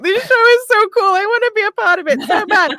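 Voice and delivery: high, pleading retold wail; hammed-up desperate fan plea; upset, mock whine. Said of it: "I remember being so upset".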